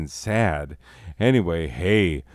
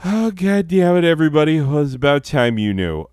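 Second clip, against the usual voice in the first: exasperated outburst; 8 semitones higher, 9 dB louder, faster than usual. Real, not acted. Blurting out a secret.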